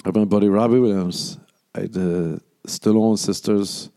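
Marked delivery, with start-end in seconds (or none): none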